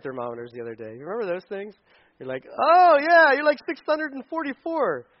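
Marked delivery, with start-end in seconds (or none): 2.5-5.1 loud, high comic exclamation; mock alarm. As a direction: burst out high and loud at an absurd reading. Acting out absurd thermometer reading.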